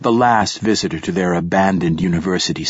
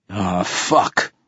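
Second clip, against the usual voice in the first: put-on hoarse rasping old man; rasped. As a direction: rasp it out, barely awake. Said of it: "rasped the old man".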